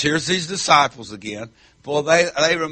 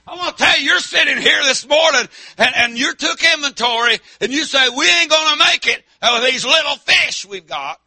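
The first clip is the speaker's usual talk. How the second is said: loud, raised country-preacher voice; full-force preaching; 10 semitones higher, 6 dB louder than usual. Acted. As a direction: preach it hard to the congregation.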